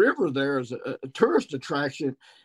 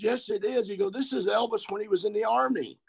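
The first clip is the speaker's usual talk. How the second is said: dad teasing; 8 semitones higher, 4 dB quieter than usual. Retold or imitated.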